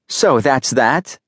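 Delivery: mildly snappy, slow questioning; snapping. A narrator's reading. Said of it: "she snapped".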